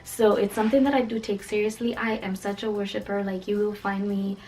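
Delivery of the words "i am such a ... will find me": normal talking voice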